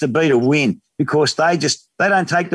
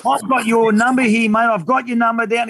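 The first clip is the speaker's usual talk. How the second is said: high-pitched retold warning; mock indignant threat; 8 semitones higher than usual. Passenger and cab driver quarrelling in retold story, mock indignation. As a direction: act out an indignant row without shouting.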